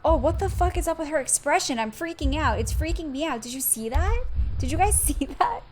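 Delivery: real fright, half laughing; freaked out. Real, not acted. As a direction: yell at chat in real alarm. Streamer reacts to creepy game.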